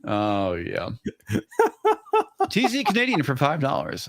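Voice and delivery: usual speaking voice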